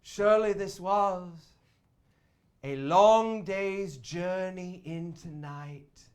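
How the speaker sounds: mother delivering the grand last line; dramatic stage line. Acted. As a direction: intone it like a final curtain line. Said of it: "she says".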